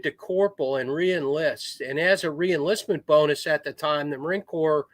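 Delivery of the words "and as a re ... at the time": ordinary talk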